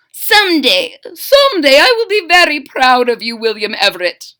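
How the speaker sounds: high, loud doting mother; proud Mama. Acted. Mama tells her son she'll be proud, high loving mother. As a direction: beam with pride at your son.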